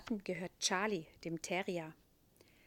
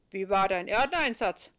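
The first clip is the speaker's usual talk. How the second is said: Saint Peter voice; 10 dB louder than usual. A narrator's reading. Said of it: "fragt Petrus".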